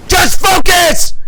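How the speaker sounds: high, loud, slow yell; shouted command to himself. Real, not acted. Streamer yelling at himself in the final push, shouting. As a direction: roar it to lock in.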